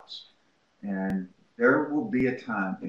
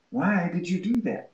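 pressing question; 9 semitones higher than usual. Retold or imitated. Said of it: "and I go".

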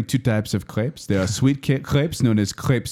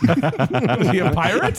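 laughing; 6 semitones higher, much slower than usual. Real, not acted.

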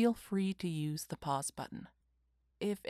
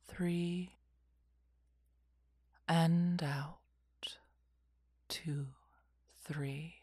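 whispered guided meditation; slow calming whisper, lots of pauses; soft spa whisper; 2 semitones lower, 4 dB quieter than usual. Acted.

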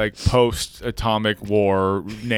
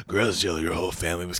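lower, soft, solemn delivery; mock grave news; 6 semitones lower, 7 dB quieter, faster than usual.

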